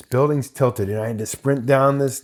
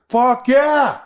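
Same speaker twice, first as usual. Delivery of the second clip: much higher, louder exuberant yell; excited cheer; 13 semitones higher, 6 dB louder than usual. Retold or imitated. Director cheers the extra's take loudly.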